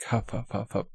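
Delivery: soft run of mock puffs; softly puffed panting noises; imitated huffing. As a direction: mimic someone panting as they go.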